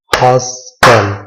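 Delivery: हॉस्टल slowly as hos, pause, tal; clap-method syllables. Shown as a demonstration. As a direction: stretch हॉस्टल into two separate beats. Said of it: "clap method के जरिए".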